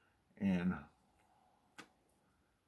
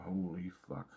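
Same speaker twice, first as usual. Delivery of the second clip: softer, low awed curse; lower, softer holy fuck; awed murmur; 3 semitones lower, 11 dB quieter than usual. Retold or imitated. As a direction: breathe it in amazement. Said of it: "I'm like".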